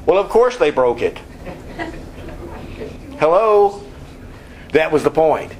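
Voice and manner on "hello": incredulous hello. Real, not acted. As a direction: toss it out like it's obvious. Preacher's mock-incredulous aside to congregation.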